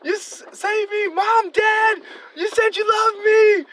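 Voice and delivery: high, loud, slow desperate wail; hammed-up pleading yell; imitated scream. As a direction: scream it like a restrained inmate pleading. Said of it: "He'd be like".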